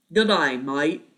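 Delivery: loud, hammed-up Aussie greeting; Australian toy-voice impression, punched out loud; mock Australian accent. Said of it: "I'm impersonating Henry".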